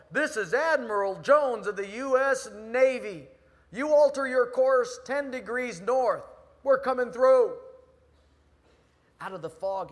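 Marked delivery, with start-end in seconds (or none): 0.1-7.6 loud, high commanding bellow; booming naval command through a megaphone; admiral on megaphone. Acted. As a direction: bark orders across the water. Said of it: "he gets on the megaphone".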